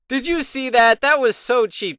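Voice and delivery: high, incredulous complaint; indignant protest. Real, not acted. Player tells viewers of a cheap move, indignant.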